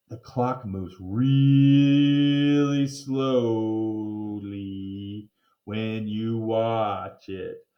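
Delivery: very slow, stretched words; drawn out. A narrator's reading. Narrator showing the endless afternoon, very slow. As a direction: drag it like time crawling. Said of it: "time dragging".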